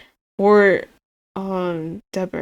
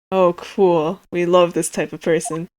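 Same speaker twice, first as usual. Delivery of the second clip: normal talking voice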